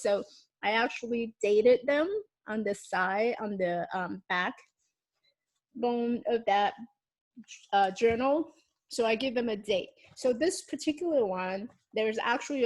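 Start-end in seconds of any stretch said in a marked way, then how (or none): none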